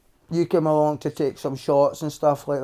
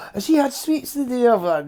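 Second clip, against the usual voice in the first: high, nagging mum voice; imitating mum; 9 semitones higher than usual. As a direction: play the suspicious mum. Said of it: "Your mum will be like".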